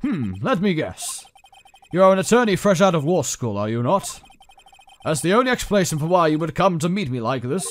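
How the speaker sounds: put-on loud, pompous British accent; hammed-up British bigwig. CEO guessing at his visitor's job, pompous British accent. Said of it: "I'm doing a British accent".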